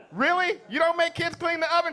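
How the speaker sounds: high, loud, quick disbelief; incredulous. Acted. Comic questioning parents in the audience, mock incredulous. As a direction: challenge the crowd, voice jumping up.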